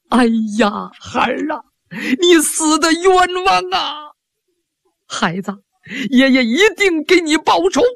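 put-on an old man's crying lament, pitch breaking upward; wailing grief. Grandfather wailing over his murdered granddaughter, crying lament. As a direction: wail it out as a grieving grandfather. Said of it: "这老头是放声大哭".

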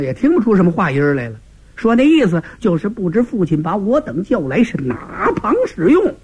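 usual speaking voice

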